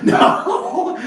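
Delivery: high shrieking burst of laughter; laughing shout that breaks into a no. Real, not acted. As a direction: crack up mid-sentence, real. Bursts out laughing at own quote.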